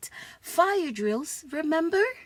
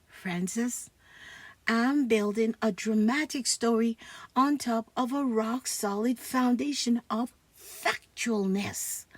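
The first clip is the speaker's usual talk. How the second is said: ordinary talk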